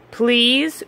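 ordinary delivery, nothing marked

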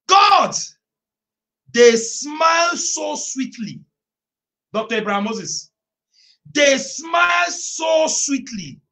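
high, loud, very slow shouted scripture; full-force preaching. Acted. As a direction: thunder the verse over the congregation.

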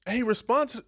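normal talking voice

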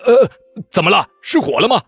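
high, loud panicked waking cry; jumpy, high-pitched fright; startled alarm. A narrator's reading. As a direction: jolt awake yelling in alarm. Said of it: "惊道".

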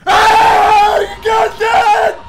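high shrieking full volume; terrified scream. Real, not acted. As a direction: scream in real panic. Screaming while attacked.

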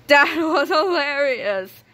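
slow words broken by a laugh; boy laughing at the memory. A narrator's reading. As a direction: giggle through the line. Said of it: "laughed Harold".

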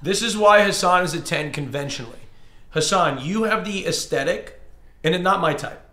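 usual speaking voice

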